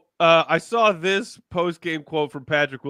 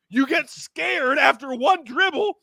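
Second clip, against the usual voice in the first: higher pitched, scolding the player; exasperated rant; 8 semitones higher than usual. Real, not acted. Podcast host ranting at a player, exasperated and raised. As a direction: tear into him, fed up.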